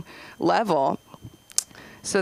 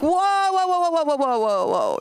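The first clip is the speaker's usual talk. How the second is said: mock alarm; 9 semitones higher than usual. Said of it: "And I was like".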